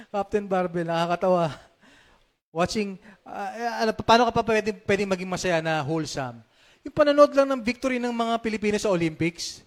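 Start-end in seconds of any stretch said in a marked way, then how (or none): none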